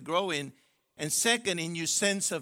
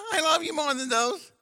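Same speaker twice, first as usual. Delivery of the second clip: mock pleading guy; 10 semitones higher, 5 dB louder than usual. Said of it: "That's what the guy would say".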